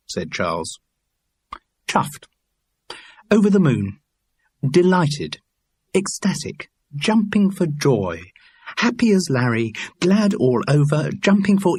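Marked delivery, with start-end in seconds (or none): 1.9-11.8 higher, hammy run of synonyms for joy; over the moon, actor showing off; delighted. Acted. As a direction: reel off each delighted phrase with theatrical relish. Actor reels off synonyms for pleased.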